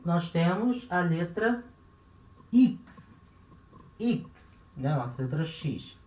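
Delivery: ordinary delivery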